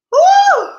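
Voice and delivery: loud, high shocked whoop; loud woo. Real, not acted. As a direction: let out a big surprised woo. Reacts to what she read.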